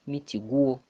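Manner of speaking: ordinary talk, nothing marked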